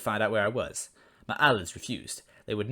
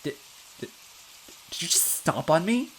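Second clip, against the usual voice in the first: disgusted horror; 6 semitones higher than usual. A narrator's reading. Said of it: "a tinge of disgust and horror in her voice".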